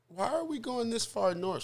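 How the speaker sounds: high, exasperated complaint; whining at the long drive north. Retold or imitated. Parent griping about the drive north, exasperated. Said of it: "I'm like".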